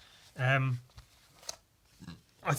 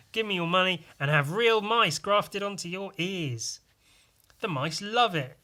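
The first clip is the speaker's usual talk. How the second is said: higher, louder hammed-up pitch; mock ad read; 5 semitones higher, 5 dB louder than usual. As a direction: sell it like a cheesy ad. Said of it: "and an ad".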